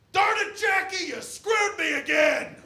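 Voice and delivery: high, loud, raging yell up the stairs; furious shout. Retold or imitated. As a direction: scream it at Jackie like the dad losing it. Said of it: "looks up the stairs and goes".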